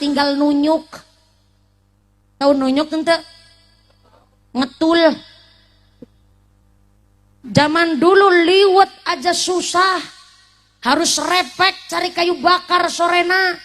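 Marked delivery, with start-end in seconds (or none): none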